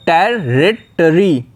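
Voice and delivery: Territory very slow, टेर-रि-टरी; syllable by syllable, territory; split into parts. Shown as a demonstration. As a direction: break territory into three slow chunks. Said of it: "तीन parts में आप लोग को इसे divide करना होगा".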